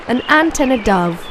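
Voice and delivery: ordinary delivery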